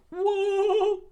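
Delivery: very high startled whoa. Acted. Sanji startled mid-fight, very high whoa. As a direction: yelp in surprise. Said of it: "Sanji was like".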